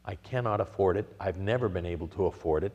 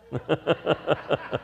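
laugh; 6 semitones higher than usual. Real, not acted. Laughs at own story.

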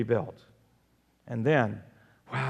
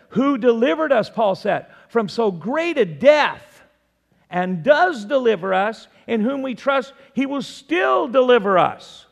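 louder, higher, emphatic preaching; forceful scripture proclamation; 6 semitones higher, 6 dB louder than usual. Real, not acted. Preacher proclaiming Paul's words on deliverance, forceful scripture reading. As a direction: proclaim the verse with ringing emphasis.

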